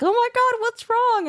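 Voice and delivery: high-pitched worried cry; mock alarm. Visitor thinks the patient is crying, mock alarm. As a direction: pitch it way up in exaggerated concern.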